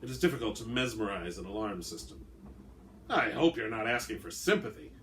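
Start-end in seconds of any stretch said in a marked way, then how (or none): none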